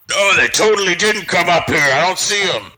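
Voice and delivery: high, put-on orc call; mock orc. Player bluffing the orcs on the stairs, high mock orc voice. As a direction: shout down as a fake orc. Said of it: "who speaks Orcish?".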